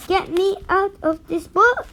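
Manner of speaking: high, loud panicked scream; scream for help. A narrator's reading. Trapped bookmark screaming on a haunted page, loud panicked scream. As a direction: yell out in panic to get free. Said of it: "and screamed".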